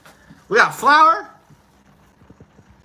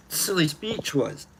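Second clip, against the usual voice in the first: ordinary delivery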